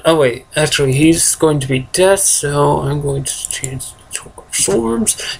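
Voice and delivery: usual speaking voice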